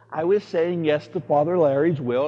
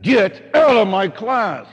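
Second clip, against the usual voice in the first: loud, slow, higher furious order; angry order; 6 semitones higher, 7 dB louder, slower than usual. Retold or imitated. Priest ordering a student out, furious. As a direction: throw the student out. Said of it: "I said".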